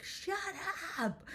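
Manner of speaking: mock angry shout, drawn out; imitated pissed-off daughter. Mom imitating her daughter snapping at noisy twins, mock pissed-off. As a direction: imitate an enraged kid, stretching the words. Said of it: "starts getting so pissed".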